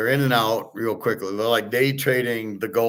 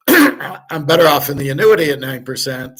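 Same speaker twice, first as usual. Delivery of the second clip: louder, put-on voice of a fussy coworker; mocking the lady at work; 4 semitones higher, 8 dB louder than usual. Mimicking the lady at work, a bit louder. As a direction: parrot her like a know-it-all colleague. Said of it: "Just like the lady at work".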